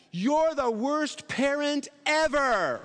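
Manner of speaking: high, slow mock child yelling; whiny kid voice, stretched out; son's yell. Imitating his son yelling he's the worst parent. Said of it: "And then he yelled at me".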